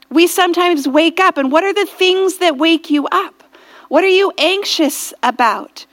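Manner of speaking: ordinary delivery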